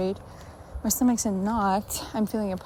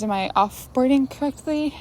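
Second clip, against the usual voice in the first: ordinary talk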